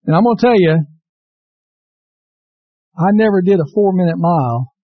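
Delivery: ordinary delivery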